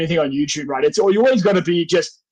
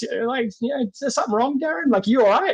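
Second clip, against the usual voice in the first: concerned boss; 6 semitones higher than usual. Retold or imitated. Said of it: "my boss at work sees that and goes".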